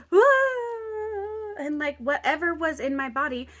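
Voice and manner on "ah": high, loud acted wail; sudden drawn-out crying "ah"; hysterical cry. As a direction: imitate bursting into sobs. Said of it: "hysterically crying, like".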